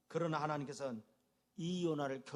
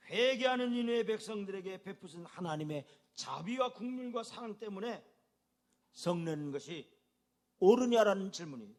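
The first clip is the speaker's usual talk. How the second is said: ordinary talk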